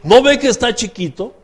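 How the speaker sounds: high-pitched, excessively courteous request; over-polite plea. Acted. Shepherd meekly asking the wolf to leave, over-polite. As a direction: ask with exaggerated politeness.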